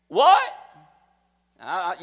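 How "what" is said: a shocked "What?" shot up very high and loud; astonished what. Acted. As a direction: blurt it out in disbelief.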